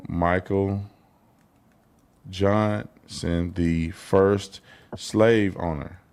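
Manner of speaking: slow, halting word-by-word reading; sounding it out. Real, not acted. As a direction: drag out each word as if spelling it. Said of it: "he's sounding it out".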